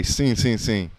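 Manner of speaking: "Sim, sim, sim" with nasal Portuguese vowels; "Sim" repeated like a local in Portugal. Shown as a demonstration. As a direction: say "Sim" the Portugal way, quick and falling. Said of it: "in Portugal, they say".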